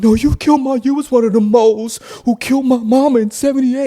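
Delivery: high, loud wailing accusation; lamenting cry. Retold or imitated. Grieving man crying out the accusation, high and loud. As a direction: wail it in grief. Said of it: "crying and lamenting over his mom".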